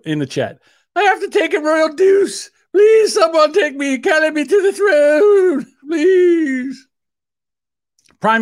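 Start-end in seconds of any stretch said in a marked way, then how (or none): 0.9-6.9 loud, high wailing royal falsetto; hammed-up Queen. Desperate Queen begging to be carried to the throne, in hammed-up wail. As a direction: plead slowly and shrilly as a mock Queen. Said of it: "For the Queen, light duties".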